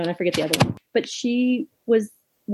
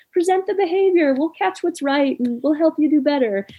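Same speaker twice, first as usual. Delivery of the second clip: encouraging trainer; 9 semitones higher than usual. Retold or imitated. Dog trainer reassuring a learner, high and encouraging. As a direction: invite the learner kindly to try. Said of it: "such a safe response".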